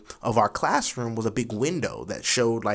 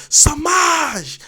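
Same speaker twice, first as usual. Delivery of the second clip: a big, high, loud yell of the name; retold scream; 10 dB louder than usual. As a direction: scream the student's name across the room. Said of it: "he screams".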